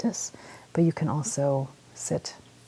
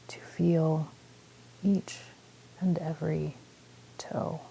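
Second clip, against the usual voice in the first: calm meditation guide with long pauses; unhurried phrases, leaving space to breathe; guided meditation; 8 dB quieter than usual. Real, not acted. Guiding listeners through a body scan, calm meditation voice.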